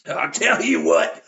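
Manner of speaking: put-on slow old hillbilly voice; hillbilly farmer. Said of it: "this old farmer hillbilly man".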